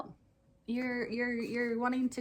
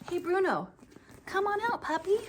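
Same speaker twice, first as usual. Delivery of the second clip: high, bright call to a shy puppy, a touch raised; 9 semitones higher than usual. Acted. Pastor calling the puppet Bruno out for the children, sing-song call.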